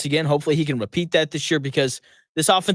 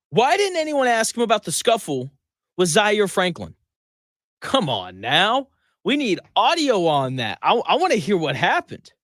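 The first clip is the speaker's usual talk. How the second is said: higher-pitched, exasperated questioning; indignant demand; 5 semitones higher than usual. Real, not acted. Radio host picking a bone with reporters, indignant. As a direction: demand answers, stretching the key words out. Host airs a mock grievance.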